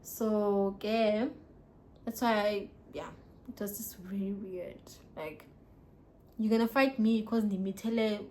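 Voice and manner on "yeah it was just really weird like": whisper. Real, not acted. Aside about weird situation.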